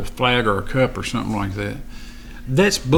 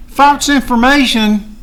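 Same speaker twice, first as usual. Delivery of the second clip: high, loud and slow, disbelieving the words False information; incredulous outrage; 10 semitones higher, 4 dB louder, slower than usual. Real, not acted. To Facebook censors, a high, loud, slowed outraged question.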